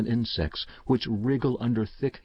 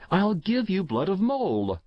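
raised, much higher call after her; shout; 9 semitones higher than usual. A narrator's reading. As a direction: call out impulsively, voice lifted. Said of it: "I shouted".

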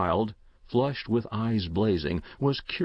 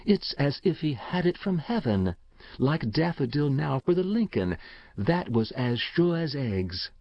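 reverent, higher-pitched awe; secret, religious voice; 5 semitones higher than usual. A narrator's reading. Bassett tells the uncle of sure tips, secretive and reverent. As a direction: speak as if sharing a revelation. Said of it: "religious voice".

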